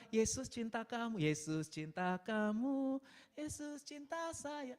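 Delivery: slow, drawn-out singsong; Sunday school teacher. Retold or imitated. Sunday school teachers telling children Jesus loves them, slow singsong. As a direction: imitate a teacher reciting slowly to children. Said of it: "guru-guru sekolah minggu bilang".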